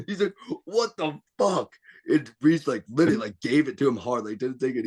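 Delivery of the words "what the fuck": higher, slow, mock stunned; shocked. Jesse to Reese after the throw, stunned disbelief. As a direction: drag it out in disbelief.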